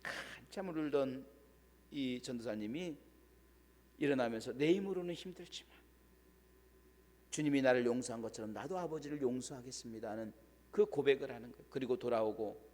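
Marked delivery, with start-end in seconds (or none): 4.5-10.1 quiet confession. Retold or imitated. Tearful confession of forgiveness.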